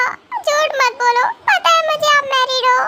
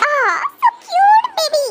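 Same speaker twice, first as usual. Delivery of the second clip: drawn-out, high-pitched gush; cooing over baby; 6 semitones higher, much slower than usual. Acted. Smitten woman gushing over the man's baby, cooing. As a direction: coo slowly in a lifted voice.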